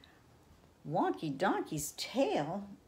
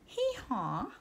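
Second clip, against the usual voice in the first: put-on little girl donkey voice; 5 semitones higher than usual. Shown as a demonstration. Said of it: "in your best little girl donkey voice".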